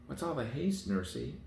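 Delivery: a hint of a high, eager child; child calling out to her nurse while running; lightly voiced little princess. A narrator's reading. Princess to her running nurse, a light high child voice.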